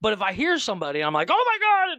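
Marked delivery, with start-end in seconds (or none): 1.3-2.0 high-pitched mock horror; exasperated outburst. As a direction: cry out as if your brain just exploded. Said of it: "my brain explodes".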